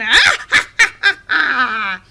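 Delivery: high, loud staccato cackle; loud witch cackle. A narrator's reading. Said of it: "cackled loudly again".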